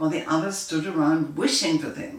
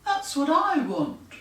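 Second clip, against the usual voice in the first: higher put-on voice; blue genie; 10 semitones higher than usual. Blue genie agrees with the green genie, higher voice. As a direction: agree eagerly. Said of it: "said the blue genie".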